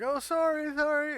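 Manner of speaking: high, sheepish apologizing voice; apologetic waitress. Acted. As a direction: chirp a quick flustered apology.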